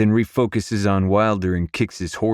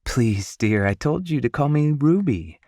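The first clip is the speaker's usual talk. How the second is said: higher-pitched motherly insistence; kindly insisting; 8 semitones higher than usual. A narrator's reading. Mom asking a guest to use her first name, insistent. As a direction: gently correct a guest, voice lifted. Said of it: "my mom insists".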